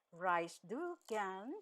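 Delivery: "Reist du gern" drawn out slowly; slow teaching repeat. Shown as a demonstration. Teacher repeating an informal German question for learners, slowly. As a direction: stretch "du", each word separate.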